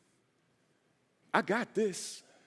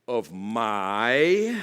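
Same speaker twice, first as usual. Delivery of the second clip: "my" drawn out and rising; emphasis on my; 3 semitones lower, 4 dB louder than usual. Shown as a demonstration. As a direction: lean long and hard on "my". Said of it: "put emphasis on that word".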